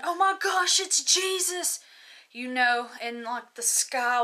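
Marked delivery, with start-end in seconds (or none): 0.0-1.8 high-pitched re-enacted cry of alarm; retold yelling. Telling her dream of seeing Jesus in the sky, retold yelling. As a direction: call it out high, imitating a yell. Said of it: "screaming, I'm, like, yelling".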